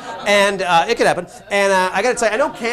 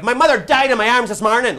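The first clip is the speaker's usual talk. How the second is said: raised, louder, pressing delivery; mock grave urgency; 4 semitones higher, 6 dB louder than usual. Host likening Irish friendliness to a death announcement, with mock urgency. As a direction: blurt it like dire news. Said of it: "it's with the urgency of".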